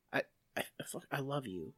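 soft, halting, ashamed I love you; embarrassed confession. Retold or imitated. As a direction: admit it like a shameful secret. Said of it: "like he's admitting to being a chronic bedwetter".

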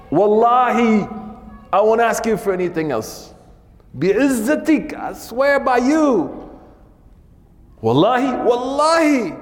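high, pleading voice begging God. Acted. Man begging God to let him into Jannah, pleading high. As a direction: beg like a man asking one last favour.

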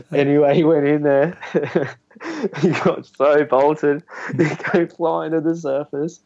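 high-pitched, struggling through laughter; laughing while telling. Real, not acted. Podcast guest retelling a prank on his mate, laughing. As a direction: tell it while cracking up at your own prank.

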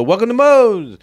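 high, loud sing-out welcome; imitated store-wide greeting; shouted staff greeting. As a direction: call it out like restaurant staff. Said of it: "and they go".

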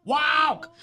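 high, excited call to a dog; WALK sung out high to a pet; changed dog-calling tone. Shown as a demonstration. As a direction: pitch it way up to rouse the dog. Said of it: "his tone of voice changes so much".

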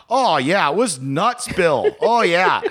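animated Boston witness impression; Boston local witness. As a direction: imitate a Red Sox fan telling his story in thick Boston. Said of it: "insanely fat dude wearing a Red Sox".

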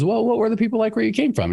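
put-on lifted high voice for the old man at the crossroads; wise old man.

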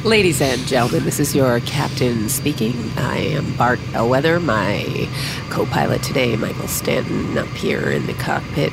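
airport announcement. Acted. Captain addresses the cabin.

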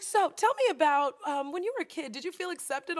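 high, loud, falsely cheery question; mock bright therapist. Imitating the therapist after the gut punch, mock cheery. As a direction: chirp it like a sweet trap. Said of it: "She's like".

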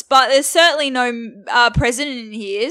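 put-on loud Australian accent, protesting; accidental Australian accent. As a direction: deny it loudly in an Australian accent. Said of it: "so why am I Australian?".

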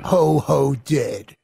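low spooky horror-trailer growl; ominous trailer voice. Acted. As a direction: intone it like a creepy movie tagline. Quoting movie trailer line.

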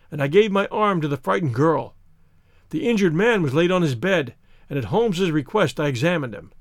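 ordinary delivery, nothing marked

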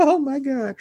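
can't help laughing at it; a real, high, amused gasp of disbelief. Real, not acted. Reader laughs at the quote.

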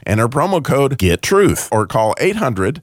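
upbeat radio ad read. Acted. Host reading a sponsor ad, upbeat ad voice. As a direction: sell it like a sponsor spot.

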